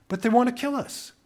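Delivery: high-pitched retold pleading; child's alarmed protest. Eight-year-old protests to the adult, alarmed.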